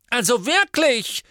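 high, loud indignant witch; indignant Madame Malkin; exasperated shopkeeper. A narrator's reading. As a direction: huff it out, scandalised. Shopkeeper after customers leave.

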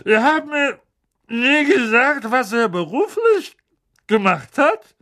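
loud, high, slow bossy aunt; Aunt Marge voice. A narrator's reading. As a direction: boom it out like a tipsy aunt.

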